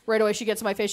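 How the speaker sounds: ordinary delivery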